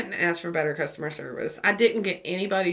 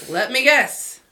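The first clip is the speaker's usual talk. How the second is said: louder, higher knowing exasperation; retold exasperation; 5 semitones higher, 6 dB louder, slower than usual. Driver answering the mechanic's bad news, exasperated. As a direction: cut in as if expecting the worst.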